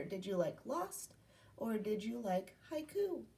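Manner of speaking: ordinary talk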